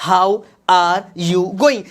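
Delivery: slow, spaced-out "How are you going"; word by word. Shown as a demonstration. Teacher repeats sentence word by word. (एक-एक शब्द धीरे-धीरे)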